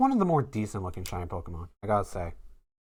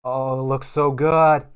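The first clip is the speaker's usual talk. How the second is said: excited; 3 semitones higher, 8 dB louder, slower than usual. Real, not acted. Admiring the pulled card.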